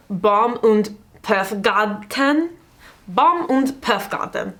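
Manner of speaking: slow, overdone German pronunciation of Baum und Puffgarten; mock German accent. Shown as a demonstration. Fashion vlogger names a brand in a mock German accent. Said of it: "I sound like Inventing Anna trying to do a German accent".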